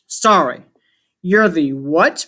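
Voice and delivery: usual speaking voice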